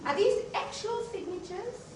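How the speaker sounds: high, doubtful question mocking skeptics; incredulous quote. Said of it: "people in law were looking at me funny".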